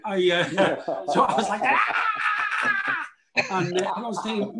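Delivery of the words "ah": high comic crying wail; hammed-up sobbing howl; mock bawling. Actor re-enacting tears he couldn't stop, mock wail. Imitates his own crying.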